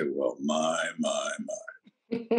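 famous tagline. Retold or imitated. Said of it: "slam my notebook on the desk and I'd say".

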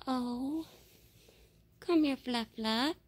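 ordinary delivery

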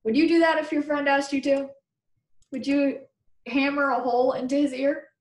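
usual speaking voice

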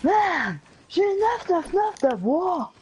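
high, slow mock gamer rage; raging gamer. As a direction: play the furious gamer for laughs. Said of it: "Imagine le gars, il rage à cause de ça".

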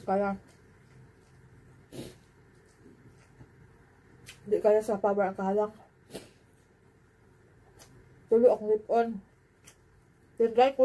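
talking between bites; speaking with food at lips; mouth partly full, chewing. Real, not acted. Seen 0: Chews before, speaks with food at lips, then bites again.

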